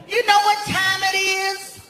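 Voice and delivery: high, sassy challenge; sassy clerk. Retold or imitated. Night clerk scolding the late guest, high and sassy. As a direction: throw it back with attitude.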